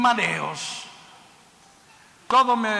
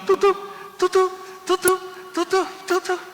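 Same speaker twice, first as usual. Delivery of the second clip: imitated heartbeat; 9 semitones higher than usual. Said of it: "encontraron un corazón latiendo".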